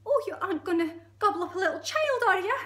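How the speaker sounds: high, loud, alarmed squeal; aghast monkey. Acted. Monkey realising a child is prey, high alarmed voice. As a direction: squeak it in disbelief.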